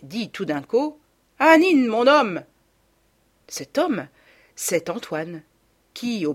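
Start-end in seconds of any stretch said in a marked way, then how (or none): 1.4-2.4 loud, slow solemn call; energetic, biblical. A narrator's reading. Mother summons her husband to dance, loud solemn call. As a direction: proclaim it like a prophetess. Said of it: "devenant énergique, presque biblique".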